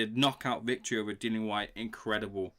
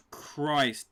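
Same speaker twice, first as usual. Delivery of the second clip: ordinary talk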